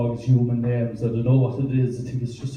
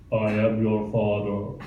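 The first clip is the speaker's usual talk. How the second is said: slow, lower Star Wars villain line; 5 semitones lower, 4 dB quieter, slower than usual. Acted. Dad playing Star Wars with his boys, mock-villain voice. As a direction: intone it slowly, like a film villain. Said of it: "we pretend we're in Star Wars".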